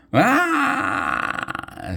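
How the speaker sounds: very high scream of fright; terrified scream. A narrator's reading. Lancey fleeing the giant, high terrified scream. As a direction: shriek in sudden panic. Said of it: "screamed Lancey".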